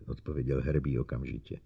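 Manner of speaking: ordinary delivery, nothing marked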